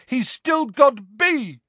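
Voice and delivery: high shout, drawn out slowly; shouted from above. A narrator's reading. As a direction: yell it down, stretching the words. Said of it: "shouted a voice from above them".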